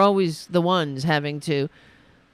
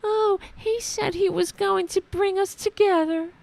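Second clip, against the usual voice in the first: high, whiny mock complaint; mock crying right-winger; 12 semitones higher than usual. Host mocking right-wingers crying in a high voice. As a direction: whimper it like a sore loser. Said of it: "right-wingers cry".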